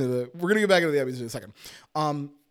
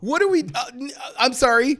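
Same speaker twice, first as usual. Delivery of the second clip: incredulous outburst; 8 semitones higher, 6 dB louder than usual. Real, not acted. Incredulous rant about Emmy snub.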